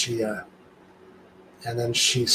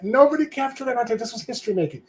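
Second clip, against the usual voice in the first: high-pitched mock dismay; retold outcry; 11 semitones higher than usual. Lamenting that history went unrecorded, high-pitched dismay. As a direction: exclaim it, voice way up.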